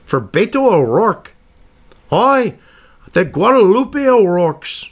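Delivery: slow, lilting mock Irish accent; badly faked Irish accent, pitched high. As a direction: put on a hammed-up Irish brogue. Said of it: "That was a real shitty Irish accent".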